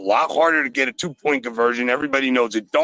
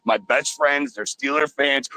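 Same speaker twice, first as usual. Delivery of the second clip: ordinary delivery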